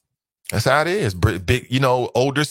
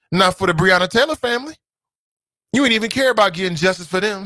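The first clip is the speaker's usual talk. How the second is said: ordinary talk, nothing marked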